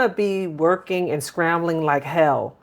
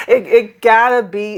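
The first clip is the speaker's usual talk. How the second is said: laughing; 5 semitones higher, 6 dB louder than usual. Real, not acted. Laughing at own struggle.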